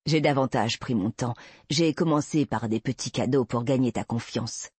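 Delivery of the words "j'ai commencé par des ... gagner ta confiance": ordinary delivery, nothing marked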